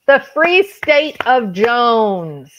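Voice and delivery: loud, drawn-out burst of triumph; triumphant recall. Real, not acted. Host suddenly remembers the movie title, triumphant shout. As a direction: call it out loud and slow.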